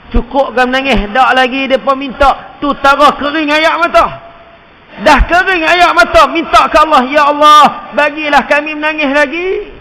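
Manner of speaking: high, loud sermon cry; impassioned preaching; fiery preaching. Acted. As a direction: build to a desperate plea. Preaching at full force.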